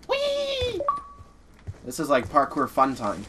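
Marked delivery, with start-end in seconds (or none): 0.1-0.8 high, playful squeal of glee; gleeful whee. Real, not acted. Playful jump in game.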